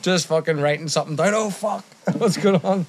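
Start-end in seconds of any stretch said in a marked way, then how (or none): 1.3-2.9 mock panicked teacher, pitched up; jolted, high-pitched mock alarm; startled teacher. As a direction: act out being startled mid-task, higher. Teacher startled by banger in class.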